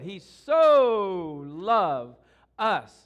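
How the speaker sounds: preacher's slow booming climax; booming so loved. Acted. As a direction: belt it out slowly.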